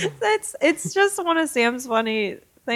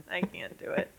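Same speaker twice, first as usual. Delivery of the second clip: whispered; 13 dB quieter than usual. Real, not acted. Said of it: "You're just gonna edit that out".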